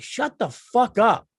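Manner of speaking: slow, drawn-out shut the fuck up, pitched high; angry retort. Retold or imitated. Comic snapping back at fans, slow and exasperated. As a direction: drag it out in mock outrage. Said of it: "And I'm going like".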